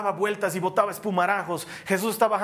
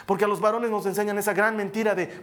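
usual speaking voice

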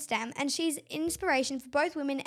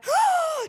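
excited gasp; 12 semitones higher, 7 dB louder than usual. Real, not acted.

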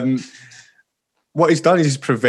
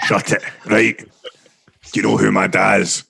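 put-on broad Scottish accent; 4 semitones lower, slower than usual. Mock Scottish lawyer in court.

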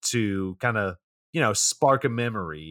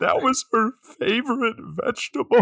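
mock tearful; 13 semitones higher, 3 dB louder than usual. Diner weeping over food.

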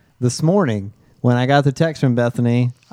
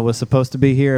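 usual speaking voice

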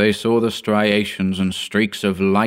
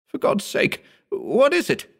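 put-on desperate young assistant. A narrator's reading. Hugh pleading.

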